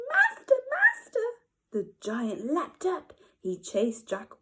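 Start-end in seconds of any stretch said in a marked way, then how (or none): 0.1-1.3 very high, loud harp shriek; magic harp crying for its master. Acted. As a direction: cry out in shrill alarm. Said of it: "but it shrieked".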